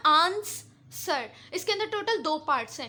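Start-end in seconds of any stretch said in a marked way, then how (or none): 0.1-1.3 answer very slow, long आंस, then सर after a gap; first part stressed. Shown as a demonstration. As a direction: stretch आंस, pause, drop सर. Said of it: "जो पहला वाला part है ना".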